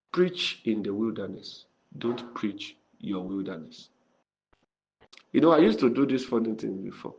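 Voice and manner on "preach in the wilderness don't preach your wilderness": slow, soft repetition of the lesson. Shown as a demonstration. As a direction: say it slowly so it sinks in. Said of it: "repeated lesson, slowed".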